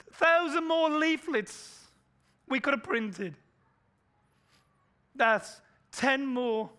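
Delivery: high, loud, slow lament of regret; anguished cry. Pretend. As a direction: wail over missed chances. Imagined regret, echoing Schindler.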